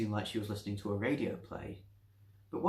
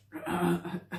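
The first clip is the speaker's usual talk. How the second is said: nervous throat clearing; 8 semitones higher, 5 dB louder than usual. Acted. The doctor clearing his throat nervously. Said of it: "with much clearing of his throat".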